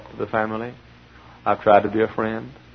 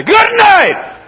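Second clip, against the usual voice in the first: sudden high loud cry; mock exclamation; 10 dB louder than usual. Preacher to congregation, a high loud mock cry. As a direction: yelp it out in mock surprise.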